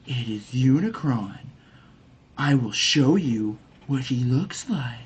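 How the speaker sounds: mock seductive Quintessa; hammed-up sultry alien queen; sultry villain voice. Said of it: "ready to just bone someone".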